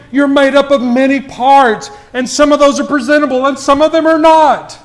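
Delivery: high, driving preacher's delivery; impassioned preaching. Acted. Preacher urging a church to be united, impassioned. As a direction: press it home to the congregation, pitch held up.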